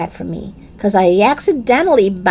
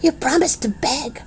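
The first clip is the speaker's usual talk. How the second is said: whisper; 4 semitones higher, 6 dB quieter than usual. Acted. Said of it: "she whispered".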